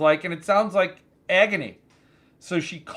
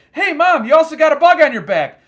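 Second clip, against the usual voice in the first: high, loud imitation of a kid; son calling out; 10 semitones higher, 9 dB louder, faster than usual. Son warns mom about the bug, calling out loudly. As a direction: holler it fast at Mom like her son.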